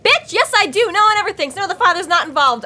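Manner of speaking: high-pitched, hammed-up outrage; mock indignant retort. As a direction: imitate a fed-up mother snapping back. Acting out Emma's indignant retort.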